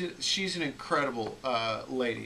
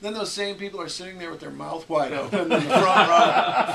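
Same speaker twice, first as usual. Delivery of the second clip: normal talking voice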